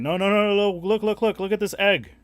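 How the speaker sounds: put-on high, loud excited kid pleading; kid's voice. Retelling the neighbor kid begging him to look, high and loud. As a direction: push the egg on him like an eager boy. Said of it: "He's like".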